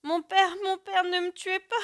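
very high, loud begging; terrified child's plea. A narrator's reading. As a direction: beg your father for your life in a child's voice. Child begs father for his life. (supplication aiguë d'enfant terrifié)

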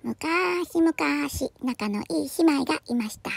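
put-on slow, soft old-tale narration; storybook opening. Introducing two close sisters, soft slow storybook narration. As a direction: open a fairy tale softly, stretching the vowels. Opens each cat tale.

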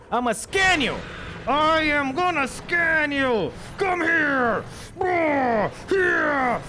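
rising, high shout; battle shout. Acted. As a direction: charge in yelling at it. Hunting creature with scanner.

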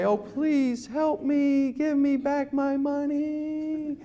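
hammed-up begging caller; mock begging. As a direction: beg the phone agent pitifully. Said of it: "you'd beg the guy".

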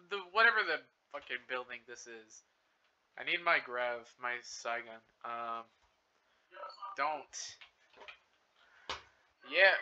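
ordinary delivery

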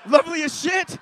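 loud, laughing "Lovely as shit"; loud laughing punchline; shouted punchline. Real, not acted. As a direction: bellow it at the crowd. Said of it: "punchline shouted".

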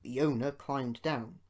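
ordinary delivery, nothing marked

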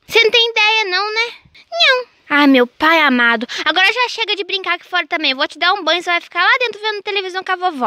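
mother scolds João Vitor about climbing; lecturing mom. Pretend. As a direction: tell off the naughty boy firmly.

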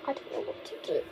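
scared whisper. Real, not acted. Scared in haunted house.

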